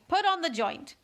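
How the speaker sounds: high, loud royal order; imperious command. Acted. Queen orders the waiters, high and imperious. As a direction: bark it like a bossy queen.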